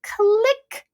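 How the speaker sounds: very high, drawn-out little "click"; imitated click. Vlogger marking her sudden realization, imitated click. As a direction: pitch it up like a switch flipping. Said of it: "all of a sudden it was like".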